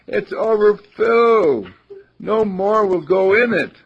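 high, loud, slow outburst; blurted out. Acted. Professor bursting out at the overflowing cup, loud and high. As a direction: blurt it out in exasperation. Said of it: "The professor blurted out".

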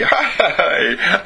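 bright, high laughing hi; giggly hello. Real, not acted. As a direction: laugh into the greeting.

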